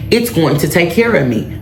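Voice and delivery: ordinary delivery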